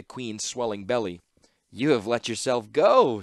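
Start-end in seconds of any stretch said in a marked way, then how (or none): 1.7-3.2 slow, louder, drawn-out sneer; catty jab. Acted. Ashnod mocking the pregnant queen, slow catty sneer. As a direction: savor the insult.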